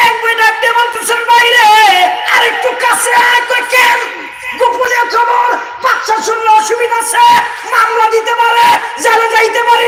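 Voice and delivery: high, loud sermon shout; a roaring waz preacher calling out over the crowd; fiery preaching. Acted. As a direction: preach it at full force to the crowd.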